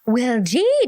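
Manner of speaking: usual speaking voice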